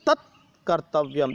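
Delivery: ordinary delivery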